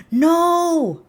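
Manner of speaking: loud, high, drawn-out cry; dismayed shout. Real, not acted. Streamer finding old emo game gone, dismayed shout.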